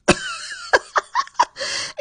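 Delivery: rapid, high-pitched laughing; real giggling fit, high-pitched; high laughter. Real, not acted. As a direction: burst out in a high cackle. Laughing after quoting the heckled man.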